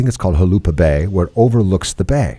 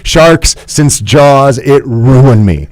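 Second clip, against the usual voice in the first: slow emphatic confession; 6 semitones higher, 5 dB louder, much slower than usual. Retold or imitated. Confessing shark fear since Jaws.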